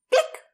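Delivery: high little click sound; click noise imitation. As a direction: make a quick click noise. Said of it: "No sé cómo hacer el ruido".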